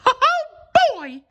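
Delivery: very high falsetto squeal of delight; Mickey crying out. A narrator's reading. Mickey opening the box, high excited squeal. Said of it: "he cried".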